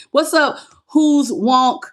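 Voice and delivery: ordinary delivery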